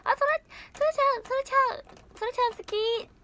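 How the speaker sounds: very high-pitched teasing mimicry; exaggerated high voice, poking a little fun; ななみちゃん impression. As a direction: squeal it out in a high put-on voice. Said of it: "ななみちゃんのモノマネ".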